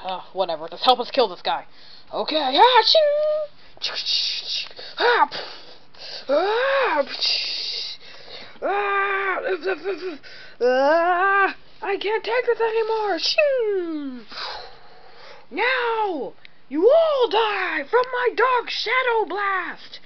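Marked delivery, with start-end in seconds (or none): 2.1-14.2 high strained fight grunts; battle cries. Acted. 15.5-20.0 high, slow villain boast. Acted. Villain's dark attack speech.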